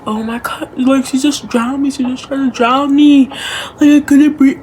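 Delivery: put-on high, loud panicky child voice; panicked little girl. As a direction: play a frantic little girl. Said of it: "little girl screaming".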